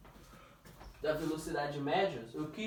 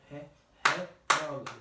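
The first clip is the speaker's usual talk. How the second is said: "retrógrado" drawn out slowly with a strong beat; stress searched out; 3 semitones lower, slower than usual. Shown as a demonstration. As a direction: sound out "retrógrado" to find its stressed syllable. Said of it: "Onde que é o acento de retrógrado". (retrógrado devagar, marcando a sílaba tônica)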